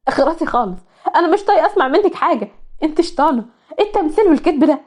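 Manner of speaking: a hint of furious betrayed girl; angry. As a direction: lash out at the traitor. Malak confronts betrayer.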